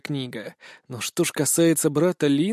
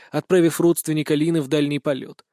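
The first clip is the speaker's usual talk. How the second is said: plain reading voice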